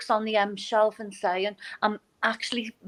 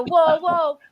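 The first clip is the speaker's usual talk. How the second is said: high, louder mock-panicked objection; alarmed protest; 8 semitones higher, 4 dB louder than usual. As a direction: jump in to stop someone, playfully outraged. Acts out stopping a book buyer.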